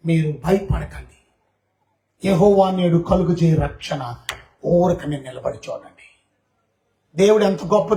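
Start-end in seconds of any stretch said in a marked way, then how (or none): 0.1-6.0 lower, slow, steady reassurance; calm reassurance. Acted. Moses calming the frightened people, low and slow. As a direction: settle a frightened crowd.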